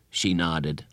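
usual speaking voice

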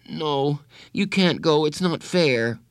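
put-on high, childlike protest; upset protest. A narrator's reading. Boy begs his counselor not to go, upset.

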